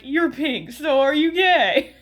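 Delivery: hammed-up nosy question; mock blunt questioning. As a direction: blurt it out like a tactless host. Mocks blunt question on game show.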